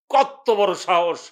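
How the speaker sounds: drawn-out, indignant exclamation; slow indignation. Acted. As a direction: stretch the words out slowly in outrage. Brother's indignation, first take.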